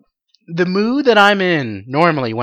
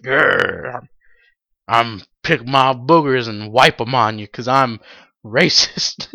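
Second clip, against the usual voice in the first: lightly growled villain cop, lower; low, lightly put-on thug voice; mock growling cop; 5 semitones lower, slower than usual. Ugly cop voiced in retelling.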